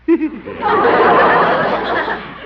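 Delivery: high, breathy giggle; a sly, breathy giggle; trademark giggle. Acted. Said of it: "his trademark giggle".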